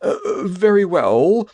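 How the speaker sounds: said sadly. Acted. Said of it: "he said sadly".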